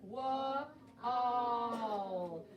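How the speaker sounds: Wa, then a long all, in two parts; sounding out wall. Shown as a demonstration. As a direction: split the word and hold the all. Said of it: "count the sounds you hear in wall".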